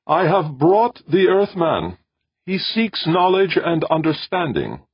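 high, loud, measured proclamation; calling out strongly. A narrator's reading. Old man announces the Earthman to the ship, calling out. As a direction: raise the voice to announce a visitor. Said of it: "he called in a stronger voice".

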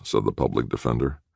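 ordinary delivery, nothing marked

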